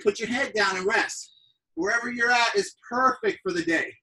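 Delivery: usual speaking voice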